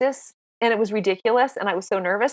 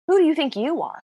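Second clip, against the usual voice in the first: scornful self-talk, rising high at the end; high, fast jeering "who do you think you are"; mocking inner critic; 7 semitones higher than usual. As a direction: sneer at yourself. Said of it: "I kept thinking".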